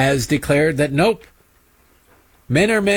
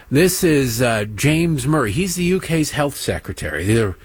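ordinary talk, nothing marked

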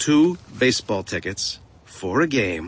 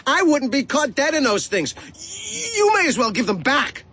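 put-on high-pitched indignant kid; defiant protest; 13 semitones higher than usual. A narrator's reading. Boy refusing gift from Ma, high and defiant.

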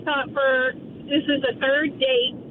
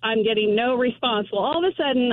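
normal talking voice

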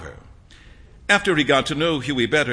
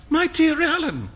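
a sharp high exclamation; alarmed cry; 14 semitones higher than usual. A narrator's reading. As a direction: cry out in dismay. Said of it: "cried Huey".

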